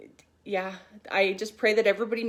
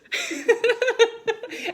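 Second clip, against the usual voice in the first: high burst of laughter, louder; real laugh; 6 dB louder than usual. Real, not acted. Mother laughing as her daughter teases her: high bursting laugh.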